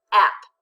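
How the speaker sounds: "App" said alone as a short closed syllable, loud; syllable without accent. Shown as a demonstration. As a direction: read "App" by itself with a short a. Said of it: "first syllable without the accent".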